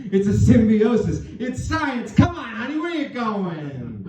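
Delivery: high, rising comic desperation; hammed-up husband pleading; desperate pleading. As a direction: call after a departing wife, stretching the last word. Acts pleading after fleeing wife.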